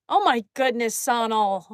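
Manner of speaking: ordinary talk, nothing marked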